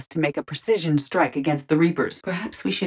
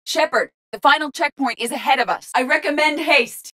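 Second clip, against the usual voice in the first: raised, high-pitched callout; urgent urging; 8 semitones higher than usual. Acted. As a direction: hurry the commander along. Urging haste in battle.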